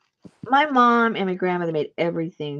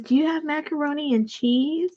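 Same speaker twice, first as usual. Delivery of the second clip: put-on pitched up like a hopeful six-year-old; mimicking a little boy asking for his favourite food; little kid voice; 9 semitones higher than usual. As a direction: ask it the way a small boy would, higher.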